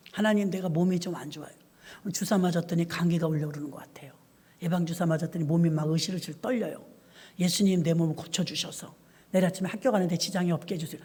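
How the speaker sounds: soft, whispered plea to God; hushed child prayer. Retold or imitated. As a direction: murmur it like a sick kid praying alone. 아이가 혼자 조용히 기도. (아픈 아이가 하나님께 속삭이듯 하는 기도)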